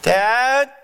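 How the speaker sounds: high, rising call; son yelling out. Acted. Son calling his father after lights out, yelling. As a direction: call out for Dad from bed. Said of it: "the son yells out".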